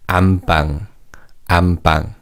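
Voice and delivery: 안방 read with an M closing the first syllable, am-ppang; N changed to M. Shown as a demonstration. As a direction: close the lips on M in "안방", as am-ppang. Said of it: "changes to mium".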